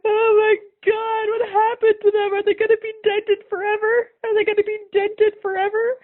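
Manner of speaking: high, loud wailing plea, crying through it; sobbing child. Retold or imitated. Injured child sobbing to the teacher, high crying wail. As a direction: wail it like a panicked crying kid. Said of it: "apparently I just kept saying".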